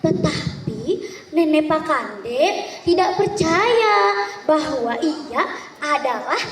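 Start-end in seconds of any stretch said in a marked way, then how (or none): none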